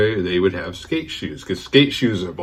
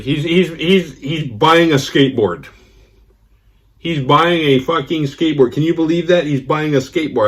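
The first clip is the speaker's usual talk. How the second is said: high, louder mock outrage; exasperated friend; 6 semitones higher, 3 dB louder than usual. Friend ranting to his wife about the skateboard. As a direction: ham up his disbelief.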